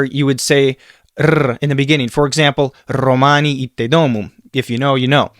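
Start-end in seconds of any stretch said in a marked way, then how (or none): 1.2-1.6 R as a tongue trill; rolled R. Shown as a demonstration. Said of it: "R's are rolled in Latin". 2.9-4.3 ROMANI opening on a long trilled r; rolled R. Shown as a demonstration. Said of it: "R's are rolled in Latin".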